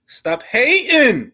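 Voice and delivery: drawn-out call at haters; stop hating. Real, not acted. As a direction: stretch it out high and slow, calling them out. Yelling at haters on stream.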